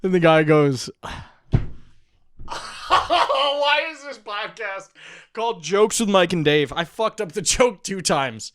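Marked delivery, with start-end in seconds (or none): 2.9-8.5 slow, high words through laughter; laughing. Real, not acted. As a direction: crack up at your own flub. Laughing at own flubbed joke.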